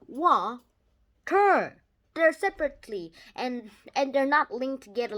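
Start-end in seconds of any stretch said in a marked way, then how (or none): 0.1-1.8 "Wa-ter" said as two separate beats; teacher clapping out "Wa-ter"; split into syllables. Shown as a demonstration. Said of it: "water has two syllables because of this".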